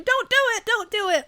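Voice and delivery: high-pitched frantic mock yell. Scientist outside the window warning against the trials, mock yelling. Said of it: "waving his arms and yelling".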